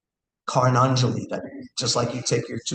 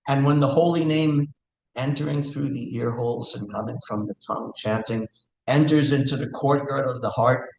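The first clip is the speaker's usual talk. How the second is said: ordinary talk, nothing marked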